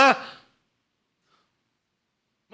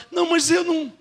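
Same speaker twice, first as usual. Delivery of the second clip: higher, insistent no; stubborn objection; 7 semitones higher than usual. Retold or imitated. Believer stubbornly refusing the preacher's words, pitched up. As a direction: push back stubbornly, pitched up.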